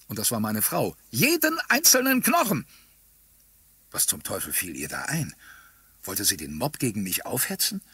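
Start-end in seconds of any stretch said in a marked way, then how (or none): 1.1-2.7 very high, raised wifely cry; fierce, high-pitched relish; bloodthirsty egging on. A narrator's reading. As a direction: shriek it, urging the mob on. Wife eggs on the mob.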